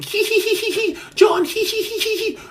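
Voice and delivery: high mock girlish giggle; girly giggling. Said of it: "When they're giggling".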